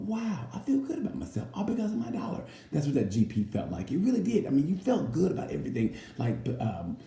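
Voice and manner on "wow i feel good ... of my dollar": high, slightly soft proud voice; smug self-praise. Pretend. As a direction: pat yourself on the back. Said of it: "You go".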